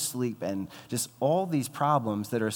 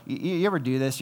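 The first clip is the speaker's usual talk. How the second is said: usual speaking voice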